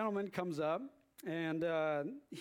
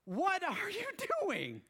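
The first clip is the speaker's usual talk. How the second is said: much higher, slow, exasperated; exasperated disbelief; 9 semitones higher, slower than usual. Retold or imitated. Preacher showing the prophets' message, slow exasperated disbelief. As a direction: drag it out, incredulous. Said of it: "is that face right there".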